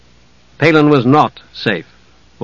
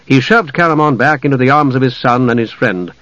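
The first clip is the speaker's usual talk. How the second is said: ordinary delivery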